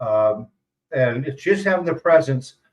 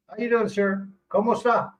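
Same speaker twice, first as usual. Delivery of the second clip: imitating polite locals; 6 semitones higher than usual. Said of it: "that's how they talk to me".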